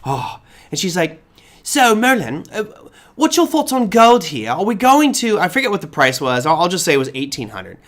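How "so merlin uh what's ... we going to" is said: imitated Australian accent, higher in pitch. TV interviewer asking her guest about gold, in an imitated Australian accent. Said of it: "has this lovely Australian accent".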